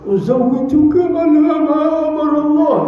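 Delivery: high, put-on tearful wedding formula; hammed-up joyful weeping; mock happy crying. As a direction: act a parent overcome at the child's wedding. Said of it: "nangis senang".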